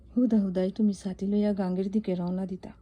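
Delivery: normal talking voice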